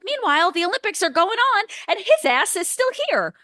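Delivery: high-pitched, incredulous mock outrage; indignant rant. Podcaster retelling outrage at a supposed liar, indignant rant.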